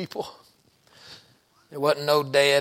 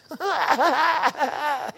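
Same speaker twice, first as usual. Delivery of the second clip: high laugh; 12 semitones higher than usual. Real, not acted. Preacher laughs at story.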